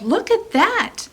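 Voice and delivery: high, excited call to the giant; children yelling. A narrator's reading. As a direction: call out to get the giant's attention. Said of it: "they yelled".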